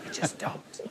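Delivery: breathy laughing whisper. Real, not acted. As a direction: laugh it under your breath. Laughing whisper after aside.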